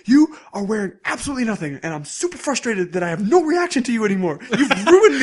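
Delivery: high, loud exasperated outburst, breaking into laughter; mock frustrated rant. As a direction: rant like a scandalized anime boy. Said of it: "he gets so mad".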